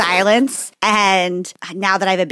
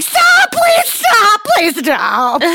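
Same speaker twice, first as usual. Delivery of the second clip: very high, drawn-out pleading shriek; exaggerated end-of-your-rope cry; desperate mock wail; 13 semitones higher, 4 dB louder, much slower than usual. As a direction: beg hysterically, hammed-up. Acted breaking point at his talking.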